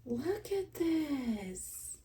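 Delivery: high, soft and very slow; awestruck, barely getting it out; speechless wonder. Real, not acted. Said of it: "I'm speechless".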